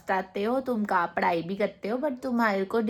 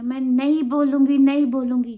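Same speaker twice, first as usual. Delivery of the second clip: louder, stubborn no; defiant shout; 3 semitones higher, 7 dB louder than usual. Retold or imitated. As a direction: yell the refusal. Child refusing mom. (ज़ोर से ज़िद्दी इनकार)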